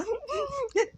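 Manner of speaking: a sudden high, breathless laugh; high strained laugh. Retold or imitated. Mother recalls mornings after crying, high laugh.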